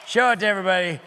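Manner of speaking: ordinary talk, nothing marked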